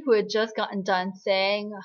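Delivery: normal talking voice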